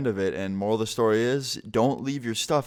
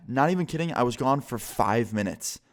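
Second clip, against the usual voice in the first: ordinary delivery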